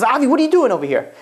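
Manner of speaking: storyteller's version of an angry scolding; mock angry challenge. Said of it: "got so angry".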